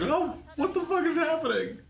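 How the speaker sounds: squealing high panicked cry; mock Ichigo scream. Fan mocks Ichigo's early-episode screaming, squealing high. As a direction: shriek it up high like a panicking kid. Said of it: "you just doing that fucking scream".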